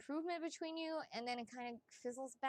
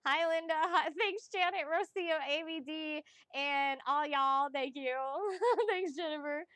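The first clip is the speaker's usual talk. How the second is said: excited greetings; 3 semitones higher, 8 dB louder than usual. Real, not acted. Greeting chat viewers loudly.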